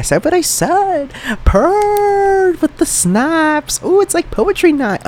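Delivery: high falsetto sass, drawn out slowly; sassy falsetto. Pretend. A host snapping his fingers at his own words, in high sassy falsetto. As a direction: play the sassy diva snapping at poetry night. Camp sassy snap-poetry bit.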